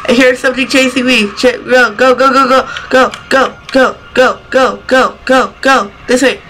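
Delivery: frantic, hurrying himself on; panicked. Real, not acted. Being chased in horror game.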